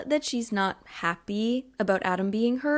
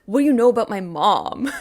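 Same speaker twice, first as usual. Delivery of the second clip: loud, defensive outburst; hammed-up angry retort; flipping out; 9 dB louder than usual. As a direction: snap back as if insulted. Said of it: "Warner kind of flips out".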